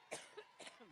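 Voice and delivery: harsh dry cough; coughing fit. Real, not acted. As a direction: cough hard, can't stop. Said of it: "I get allergic".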